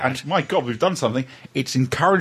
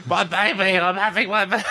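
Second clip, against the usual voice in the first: put-on quoting The Young Ones; 4 semitones higher than usual. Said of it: "it's a Young Ones episode".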